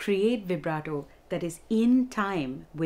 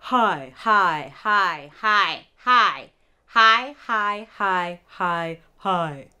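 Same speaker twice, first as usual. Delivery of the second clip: his moving from back to forward; shifting placement; 6 dB louder, much slower than usual. Shown as a demonstration. Teacher sliding voice placement across repeated his. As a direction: slide the placement along each hi. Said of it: "Do you hear the difference?".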